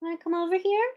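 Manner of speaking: high, soft sing-song pet call; high pet-talk calling Rufus to come over. Real, not acted. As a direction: coax the dog over sweetly. Coaxing pet.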